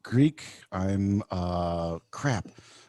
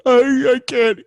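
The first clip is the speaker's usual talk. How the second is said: high, loud whiny caricature; exaggerated high-pitched helpless complaining; mocking co-host impression; 13 semitones higher, 5 dB louder than usual. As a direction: wail it in a squeaky put-on voice. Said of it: "And then you're like".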